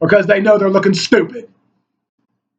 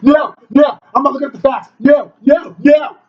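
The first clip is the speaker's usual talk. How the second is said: mocking denial; 5 semitones higher than usual. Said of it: "And like".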